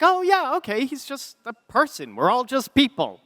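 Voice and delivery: high-pitched, breezy self-reassurance; retold relieved realization. Reassuring himself after meeting his idol, high-pitched mock-casual relief. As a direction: talk yourself down, voice lifted high. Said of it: "And I was like".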